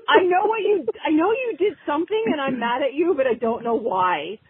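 squeaky frustrated confession; high exasperated. Retold or imitated. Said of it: "that sounds stupid".

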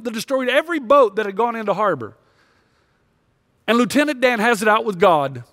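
ordinary delivery

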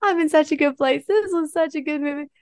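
high, dreamy contentment; blissful gushing. Retold or imitated. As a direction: float on a happy movie high.

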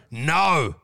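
low, blunt refusal; firm dog command. Retold or imitated. Comedian refusing a heckler, as if commanding a dog. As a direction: say it like correcting a dog. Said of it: "like you're talking to a dog".

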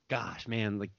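low, quiet guilty grumble; guilty self-talk. Retold or imitated. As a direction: quietly reproach yourself, sinking low. Acted guilty self-talk at drive-thru.

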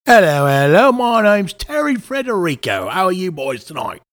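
hammed-up British caller; British accent. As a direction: greet them in a broad put-on British accent. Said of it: "A man from across the pond".

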